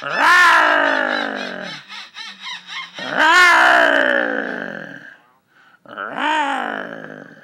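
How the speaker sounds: low, loud playful roar; monster growl. Pretend. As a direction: growl like a monster to tease the bird. Growling at the birds.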